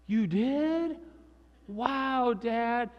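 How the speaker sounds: put-on high child voice full of wonder; amazed little boy. Little boy reacts to Dad's penny trick, amazed. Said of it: "the little boy was like".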